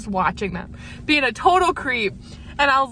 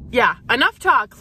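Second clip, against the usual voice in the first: usual speaking voice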